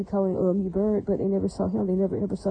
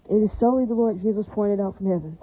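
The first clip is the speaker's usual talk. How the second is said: normal talking voice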